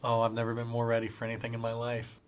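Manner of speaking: usual speaking voice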